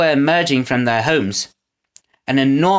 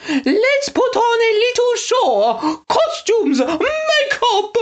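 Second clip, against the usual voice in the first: much higher, prissy put-on voice; frou-frou voice; slower than usual. A narrator's reading. Snatcher mocks Eggs in a prissy frou-frou voice. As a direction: flounce it, all airs. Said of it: "in his frou-frou voice".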